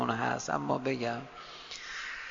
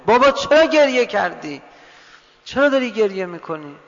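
loud, high call to father; raised voice; 5 semitones higher, 7 dB louder than usual. Acted. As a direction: call out the question high, then ease off. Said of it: "صدای فاطمه بلند شد". (با صدای بلند پدر را صدا زدن)

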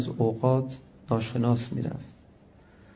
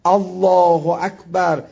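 drawn-out exclamation; 2 semitones higher, 5 dB louder, much slower than usual. Acted. Exclaims God is greatest.

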